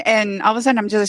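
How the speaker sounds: ordinary talk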